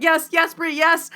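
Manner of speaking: high, loud repeated yeses; excited confirmation. Retold or imitated. Audience member cheering Bree's correct guess, loud excited agreement.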